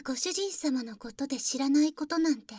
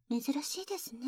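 whispering; 7 dB quieter, faster than usual. Acted.

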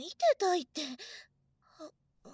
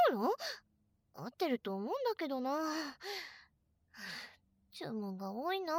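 normal talking voice

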